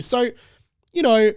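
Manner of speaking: ordinary talk, nothing marked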